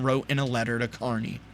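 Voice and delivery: ordinary talk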